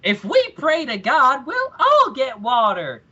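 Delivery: high, slow puppet voice breaking into laughter. Retold or imitated. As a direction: squeak it out like a pious puppet, laughing. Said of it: "one of the puppets just fucking says".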